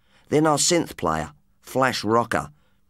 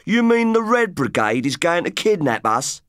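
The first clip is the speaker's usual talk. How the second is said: laughing; 6 semitones higher than usual. A narrator's reading.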